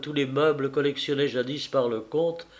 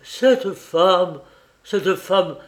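loud, higher, shocked outburst; hoarse stammer; 4 semitones higher, 6 dB louder, much slower than usual. A narrator's reading. Horrified husband facing the portrait, hoarse stammering. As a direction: force it out hoarsely, in horror. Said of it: "balbutia-t-il d'une voix rauque".

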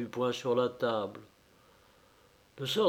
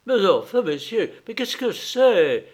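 a touch of startled stammer, higher; stammering, dumbfounded; 8 semitones higher, 7 dB louder than usual. Acted. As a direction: stammer in mild shock. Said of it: "balbutia Gestiakov, stupéfié". (bégaiement légèrement stupéfait)

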